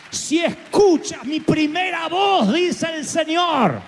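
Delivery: slow, high, loud preaching cry; preacher shouting God's words. Acted. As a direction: hammer the words home from the pulpit. Preacher climaxes message.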